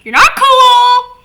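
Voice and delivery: high, loud, slow jeer; teasing shout. Retold or imitated. Fan taunting Moxie, loud high teasing jeer. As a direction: shout the put-down at him. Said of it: "I'm like".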